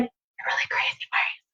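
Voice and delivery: hushed, unvoiced aside; imitating mortified whisper. Girls who moved in with her, whispering at her loudness. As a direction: whisper as if embarrassed by someone. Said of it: "and they're like".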